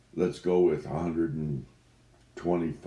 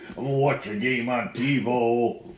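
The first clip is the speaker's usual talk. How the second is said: laughing; 9 semitones higher, 6 dB louder than usual. Acted. Laughing through celebration.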